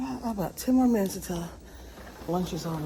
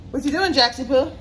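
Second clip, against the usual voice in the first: higher, sing-song talk to a dog; pet talk; 6 semitones higher, 4 dB quieter than usual. Real, not acted. Calling her dog Jaxie Poo in pet talk.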